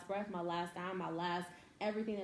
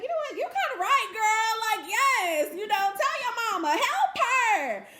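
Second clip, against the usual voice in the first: mock giddy agreement; 11 dB louder than usual. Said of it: "And I'd be like".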